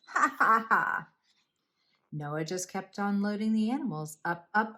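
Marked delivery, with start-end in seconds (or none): 0.1-1.1 high, loud, staccato ha-ha jeer; mocking laughter. A narrator's reading. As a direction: act out a crowd laughing at Noah. Other people mock Noah.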